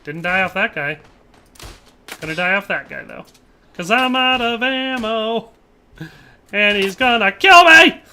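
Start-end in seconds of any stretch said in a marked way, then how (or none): 3.7-8.0 high, drawn-out wail building loud; mock panic. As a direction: wail it slowly in pretend doom. Mock lament while losing in game.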